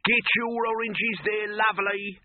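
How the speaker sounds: high, bold hawker call; lightly voiced brash market shout; mock street crier. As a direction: sell oranges with a grin. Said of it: "they shout out".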